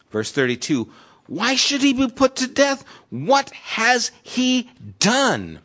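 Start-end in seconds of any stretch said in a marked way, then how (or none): 1.3-5.6 much higher, slow pleading question; Jonathan pleading. Retold or imitated. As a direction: plead like a son begging his father.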